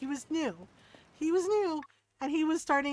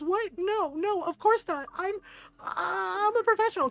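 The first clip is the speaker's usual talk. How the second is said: higher-pitched, imitating a tearful man; mock tearful protest; 7 semitones higher than usual. Doctor protesting to the grandmother who made him cry, mock tearful. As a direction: mimic someone upset and insisting on his dignity. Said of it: "He was crying".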